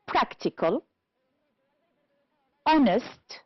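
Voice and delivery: "Practical. Honest." each stressed at the start; stress on first syllable. Shown as a demonstration. Teacher correcting an exercise, first syllables of "Practical. Honest." stressed. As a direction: hit PRAC in "Practical", HON in "Honest". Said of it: "first syllable".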